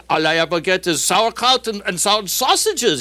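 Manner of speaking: high-pitched grumbling German; drawn-out, exasperated German character; German worker. Acted. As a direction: complain as a comic German workman. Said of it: "the German opens his, and he says".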